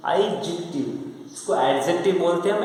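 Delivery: normal talking voice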